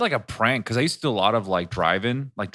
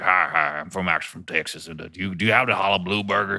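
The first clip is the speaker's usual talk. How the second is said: drive-through prank Texan voice; put-on Texan accent; 2 semitones lower than usual. Pranking a drive-through worker, Texan accent. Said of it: "just pick a random accent".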